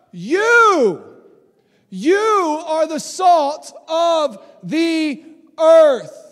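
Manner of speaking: loud, slow, stretched out in amazement; awed loud echo. Acted. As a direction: repeat the words big, as if stunned. Mary repeats Jesus to Susie.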